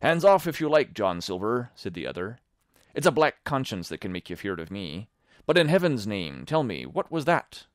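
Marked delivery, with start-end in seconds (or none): 0.0-1.7 defiant. Acted. Tom answers defiantly. 2.9-7.7 urgent demand. Acted. Tom demands an answer.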